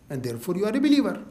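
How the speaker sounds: usual speaking voice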